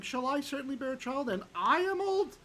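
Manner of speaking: high-pitched questioning read, climbing to the end; incredulous question. Acted. Teacher quoting God quoting Sarah's laugh, high incredulous question. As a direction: lift it steeply into disbelief on the last words.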